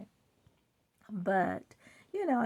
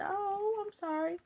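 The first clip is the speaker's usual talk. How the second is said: sympathetic surprise; 11 semitones higher than usual. Retold or imitated. Polite reply to hiccup complaint.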